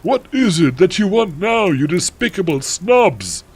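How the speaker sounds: high, irate headmaster voice; angry Dumbledore. A narrator's reading. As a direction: demand it in theatrical outrage. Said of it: "he asked angrily".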